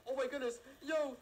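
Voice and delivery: high, loud shriek of alarm; panicked cry after being shot at; startled yell. Real, not acted. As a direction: yelp as the bullets fly.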